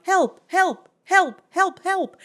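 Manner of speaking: high repeated calls, fading at the end; mirror echoes. Retold or imitated. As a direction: imitate echoes bouncing round a room. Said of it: "the echoes of the mirrors around him".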